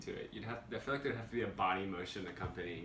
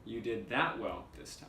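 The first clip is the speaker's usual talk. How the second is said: "that" lifted, rest falls away; stress on "that". Shown as a demonstration. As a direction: punch the word "that". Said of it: "different inflection on the words".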